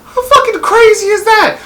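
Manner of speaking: high, disbelieving squeal; can you believe this; shocked squeal of disbelief; incredulous. Real, not acted. Outraged at the Catfish twist.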